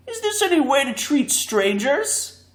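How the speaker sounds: mock-offended protest; Woozy calling out. A narrator's reading. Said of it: "called out the Woozy".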